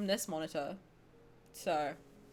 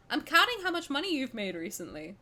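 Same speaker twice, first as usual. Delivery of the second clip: ordinary talk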